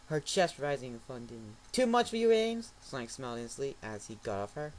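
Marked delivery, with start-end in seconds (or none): 1.7-2.7 teasing. Acted. Sonic teasing Amy.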